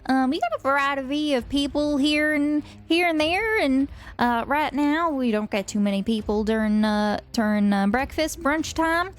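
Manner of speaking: put-on country accent. Hostess greeting folks at the door, country accent. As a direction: welcome them in with a country twang. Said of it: "has a bit of a country accent".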